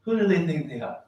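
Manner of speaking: angry sheikh shows the file, retold; mad sheikh. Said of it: "He was actually mad at America".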